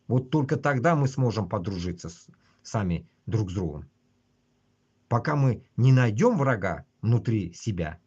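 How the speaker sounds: usual speaking voice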